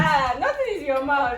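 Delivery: laughing exclaim. Real, not acted. Reacting to fiery bitter kola.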